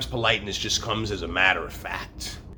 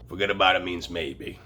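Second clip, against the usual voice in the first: ordinary delivery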